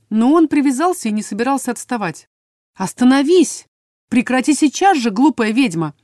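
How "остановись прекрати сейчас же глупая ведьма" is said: shouted, higher and louder; angry royal yell. A narrator's reading. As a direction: yell at her to stop. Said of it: "орал на меня Хакан".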